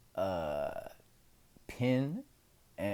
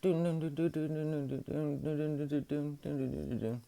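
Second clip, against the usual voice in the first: rapid high-pitched sing-song doon-doon; imitated doorbell; 7 semitones higher than usual. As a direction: mimic a doorbell being rung over and over. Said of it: "I'm hearing, like, the doorbell".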